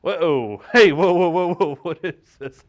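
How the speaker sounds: loud, laughing alarmed whoas; startled. Acted. Host startled by the sleazy caller, loud shocked whoas. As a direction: recoil at the pitch, loud.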